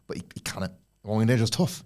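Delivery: ordinary delivery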